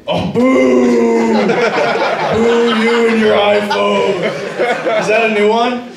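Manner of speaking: hammed-up heckler's jeer; mock booing. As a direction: boo them like a heckler, stretching it out. Acting out a crowd's jeer.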